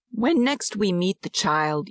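plain reading voice

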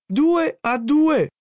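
very high, drawn-out elated yell; slow, jubilant high cry. A narrator's reading. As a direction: stretch the words into a high shout.

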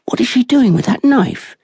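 a shocked passerby demanding to know; alarmed onlooker. Acted. Crowd questions the mother.

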